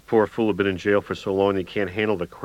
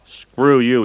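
loud, higher snap back; angry retort; 6 semitones higher, 10 dB louder than usual. A narrator's reading. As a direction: spit it out louder. Drunk friend snaps back.

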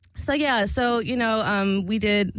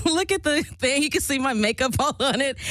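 laughing through tears; 7 semitones higher than usual. Real, not acted. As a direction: laugh it off while teary-eyed. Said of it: "I got all teary-eyed and stuff".

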